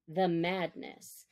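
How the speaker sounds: usual speaking voice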